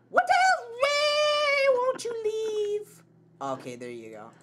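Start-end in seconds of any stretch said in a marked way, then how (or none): 0.1-3.0 shrill singsong plea. Real, not acted.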